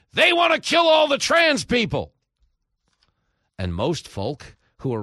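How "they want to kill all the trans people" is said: much higher, loud hysterical yell; mock outraged shout. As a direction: shriek it like a hysterical accuser. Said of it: "That position becomes".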